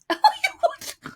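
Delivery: high burst of laughter with a snort. Real, not acted. Storyteller amused by her own impression, snorting laugh. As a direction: crack up and snort. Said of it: "I snorted".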